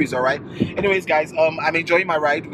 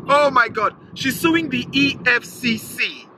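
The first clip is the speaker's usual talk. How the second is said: usual speaking voice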